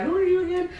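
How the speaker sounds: high mock confused lady. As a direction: play the puzzled clerk. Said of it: "she was like".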